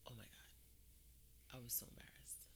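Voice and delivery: slow, hushed mortified whisper; barely voiced, low and embarrassed. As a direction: whisper it as if wanting to disappear. Reliving embarrassment under her breath.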